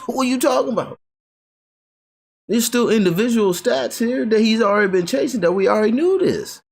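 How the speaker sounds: high laughing disbelief; incredulous. Real, not acted.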